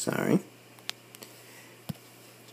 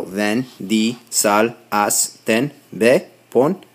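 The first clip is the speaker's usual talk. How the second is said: slow, separated words with a lift on "weapons"; syllable-by-syllable demo; much slower than usual. Shown as a demonstration. Teacher spelling out a memory phrase for class, slow and deliberate. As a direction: space out "Vin Diesel has ten weapons" word by word. Mnemonic broken into Spanish command forms.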